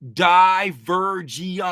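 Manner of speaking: "Divergence" slow with each syllable stretched. Shown as a demonstration. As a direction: lay out "Divergence" slowly for the chat. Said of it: "give me the correct spelling".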